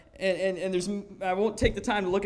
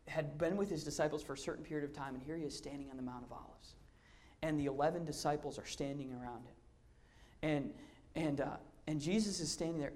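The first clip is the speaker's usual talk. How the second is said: hushed storytelling; 2 semitones lower, 8 dB quieter than usual. A narrator's reading. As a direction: tell it softly as if watching it happen. Hushed retelling of the Ascension.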